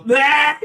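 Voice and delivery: loud, high gagging bleh; retching yuck sound; disgusted bleh. Retold or imitated. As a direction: spit it out in disgust. Spitting out mayo mac and cheese.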